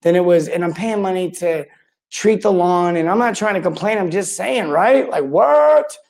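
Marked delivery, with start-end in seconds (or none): 5.2-6.0 a high, baffled "what?" at the absurdity; incredulous what. Real, not acted. Vlogger to viewers about plumbing woes, incredulous high "what?". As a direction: pitch jumps way up in disbelief.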